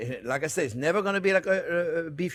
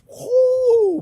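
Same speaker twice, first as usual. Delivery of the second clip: high, loud startled whoo; real startled cry as thunder breaks; surprised whoo; 8 dB louder than usual. Real, not acted. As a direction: yelp at the sudden thunder. Sudden thunderstorm hits.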